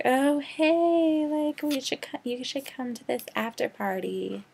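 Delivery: high, bright mock friend voice, tapering off; imitating giggly teen friends; mock valley girl invite. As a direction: ham up the fake-nice friends. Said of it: "they're like".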